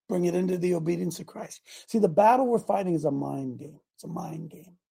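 normal talking voice

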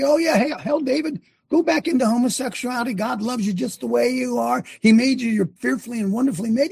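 high, mocking false reassurance; mock affirmation. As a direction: sneer while pretending to affirm. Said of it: "Can I say".

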